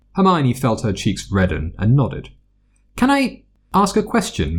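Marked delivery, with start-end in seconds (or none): 3.0-4.6 put-on higher, curious young girl; eager girl voice. A narrator's reading. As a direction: ask it like a keen pupil. Hermione asks healer.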